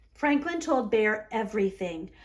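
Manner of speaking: ordinary delivery, nothing marked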